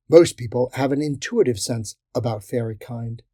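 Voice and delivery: usual speaking voice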